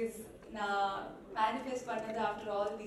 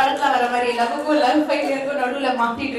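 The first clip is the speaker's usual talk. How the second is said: stage announcer; 3 semitones higher, 16 dB louder, much faster than usual. Acted. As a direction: pump up the crowd for the next act. Emcee hypes the crowd. (உரத்த மேடை அறிவிப்பாளர் குரல்)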